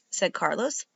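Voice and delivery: ordinary delivery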